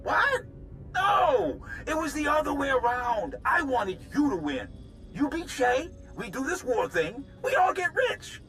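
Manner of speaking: high, agitated denial; frantic self-defence under accusation; panicked protest. Acted. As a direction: plead your case excitedly, pitch jumping up. Accused man pleads his case.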